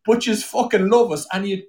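high-pitched, worked-up self-mocking tirade; comic indignant rant. Real, not acted. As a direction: rant in playful outrage, pitch held high. Comic rant about his own people.

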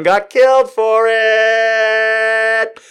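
high, very slow mock gravity; mock dramatic. Host joking about history, slow mock gravity. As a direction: draw the punchline out. Joke punchline.

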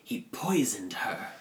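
slow villain whisper; whispered confession. Retold or imitated. Retelling the villain's confession in a slow whisper. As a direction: breathe it out like a dark secret.

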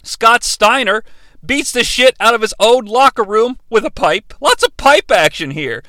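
ordinary delivery

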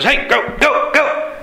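high-pitched, frantic shooing calls; acted high cries of a herder; shepherd shooing a lion. Acting shepherd shooing lion away.

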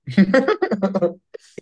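a high, real laugh at herself. Real, not acted. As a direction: laugh off the remark. Laughing at own after-party line.

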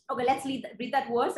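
normal talking voice

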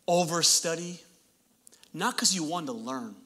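ordinary delivery

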